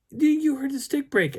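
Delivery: very high, loud mock-frantic voice; hammed-up frightened stammer; imitated panic. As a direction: squeak it out in exaggerated alarm. Acting her panic to him.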